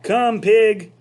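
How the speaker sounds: high, loud coaxing call; loud sing-song pig call; farmer calling. Acted. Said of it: "said Mister Zuckerman".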